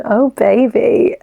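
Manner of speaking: slow, hammed-up fake moan; playful mock moan, half laughing. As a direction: drag out a silly moan. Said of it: "I fake moan".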